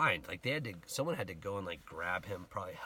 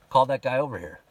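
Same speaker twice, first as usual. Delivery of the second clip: loud, higher projected command; retold call-out; 5 semitones higher, 13 dB louder, faster than usual. Jesus has the blind man fetched, retold shouted order. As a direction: call across a crowd, acted out. Jesus calls out across the crowd.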